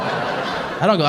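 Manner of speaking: ordinary talk, nothing marked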